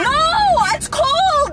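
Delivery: high, loud put-on wail; whiny protest. Caller refusing to leave car in cold, mock-distressed wail. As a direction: refuse in a hammed-up high whine.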